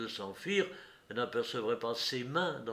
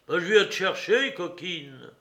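loud, raised voice, easing on the insult; angry retort; 6 semitones higher, 9 dB louder than usual. A narrator's reading. Courrapier comes to fetch the woman, angry. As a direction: answer her back hard. Man answers her in quarrel.

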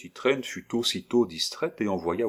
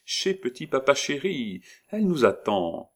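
put-on higher coaxing old woman; 7 semitones higher than usual. Disguised old woman reassures the girl, higher voice. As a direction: reassure her sweetly.

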